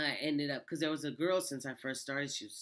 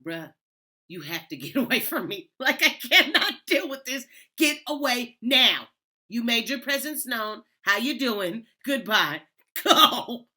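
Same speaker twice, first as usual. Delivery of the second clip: loud, high, laughing shooing; laughing shout; 9 semitones higher, 13 dB louder than usual. Retold or imitated. Coworker yelling at the ghost to leave, laughing loud. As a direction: shoo the ghost away, laughing. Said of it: "she was like".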